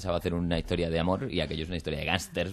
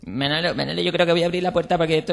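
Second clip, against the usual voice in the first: higher and louder, frantic; panicked call; 10 semitones higher, 6 dB louder than usual. Acted. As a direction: cry out in alarm. Panicked wife opening the door.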